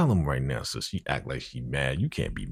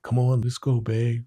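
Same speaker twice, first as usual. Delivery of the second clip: coaxing Geneva voice; 7 semitones higher than usual. Retold or imitated. Geneva pulling him away.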